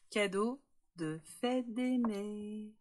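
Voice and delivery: "cadeau de fête des mères" stretched slowly, each word weighted; "cadeau de fête des mères" said slow and deliberate like a headline; drawn-out announcement. Acted. Announcing the gift theme.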